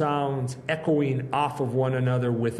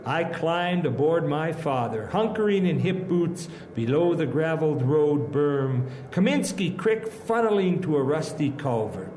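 raised, ringing recital voice; poetry recitation; 5 semitones higher than usual. Acted. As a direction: recite the poem with feeling. Recites his poem.

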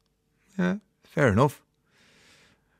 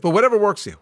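whatever stressed, higher and louder; shrugging emphasis; 9 semitones higher, 5 dB louder, faster than usual. Real, not acted. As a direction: brush it off with a push on whatever. Host shrugs off listener advice.

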